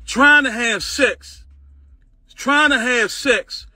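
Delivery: slow, stressed "Trying to have sex"; slow mocking repetition; slow emphatic repeat. Real, not acted. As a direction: drag it out mockingly. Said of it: "repeated for emphasis".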